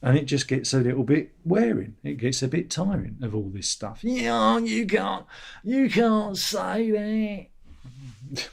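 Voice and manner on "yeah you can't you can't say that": high, drawn-out mock voice; mocking whine. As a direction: stretch it out like a smug commenter. Mocking commenters.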